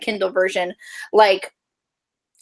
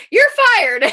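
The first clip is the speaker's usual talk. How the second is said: higher, louder, bossy mock firing; mock boss firing; 13 semitones higher, 5 dB louder than usual. Imitating Faye firing the coworker, mock bossy. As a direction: snap it out like a bossy diner owner. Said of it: "and she's like".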